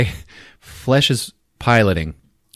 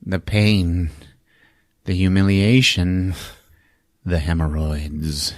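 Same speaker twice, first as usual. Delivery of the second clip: slow, halting mock-tragic monster voice; long pauses, sinking lower at the end; lamenting monster; 3 semitones lower, slower than usual. Monster bemoaning his wound, slow mock-tragic voice.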